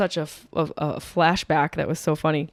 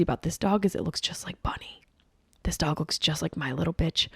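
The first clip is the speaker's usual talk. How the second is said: whispering; 7 dB quieter than usual. Real, not acted. Unvoiced whispered aside.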